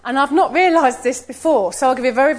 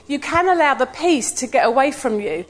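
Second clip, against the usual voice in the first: ordinary talk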